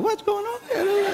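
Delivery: high-pitched puzzled wife; bewildered wife. Acted. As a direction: imitate a wife taken aback, voice way up. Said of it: "Rosie is a bit like".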